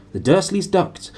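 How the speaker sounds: plain reading voice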